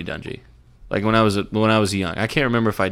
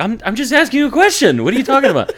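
laughing protest; 5 dB louder than usual. Retold or imitated. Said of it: "I'm like".

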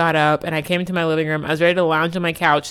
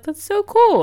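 excited reaction; 5 dB louder than usual. Retold or imitated. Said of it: "I was like".

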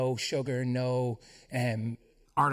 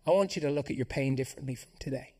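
normal talking voice

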